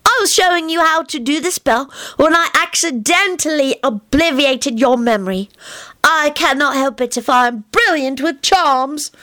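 put-on high, earnest childish voice; a little boy explaining things very seriously; young Teddy. A narrator's reading. Narrator voicing Teddy explaining to grown-ups, high childish earnest.

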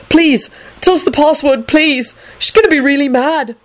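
high, urgent pleading. Acted. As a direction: beg anxiously, pitched high. Neville pleading for the password.

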